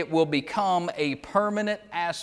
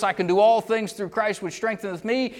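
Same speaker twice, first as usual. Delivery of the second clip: higher, rousing hurrah reading; rally verse; 6 semitones higher, 3 dB louder than usual. Shown as a demonstration. Preacher showing how people cheer the verse, rousing. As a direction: lift it like a rally cry. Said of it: "It's a rally verse".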